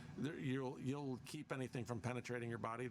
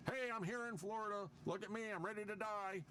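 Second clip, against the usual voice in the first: much higher, eager TV reporter voice; cartoonish storm-chasing newsman, way up in pitch; mock weatherman; 10 semitones higher than usual. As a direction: ham up a reporter thrilled to be in the storm. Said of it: "I can't remember the one guy's name".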